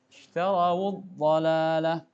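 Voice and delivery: slow "Ishtarawud dhalalah" with waw voiced as wu; waw with dhammah. Shown as a demonstration. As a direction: stretch "Ishtarawud" so the linking wu is clear. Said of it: "Wawnya diberi harokat dhammah".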